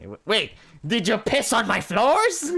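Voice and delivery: appalled yelp; shocked. Acted. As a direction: recoil in disgust. Shocked at piss remark.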